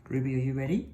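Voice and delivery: usual speaking voice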